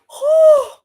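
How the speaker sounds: high loud burst of relief; relieved gasp. Real, not acted. Streamer seeing her phone charge, high loud relieved oh. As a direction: let it out as the charger works.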